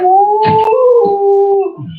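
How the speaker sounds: sudden high falsetto ooh. Real, not acted. Preacher lets out a high ooh to his listener. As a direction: let out a high, thin ooh of disbelief. Exclaiming mid street preaching.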